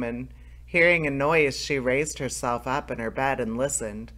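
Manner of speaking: plain reading voice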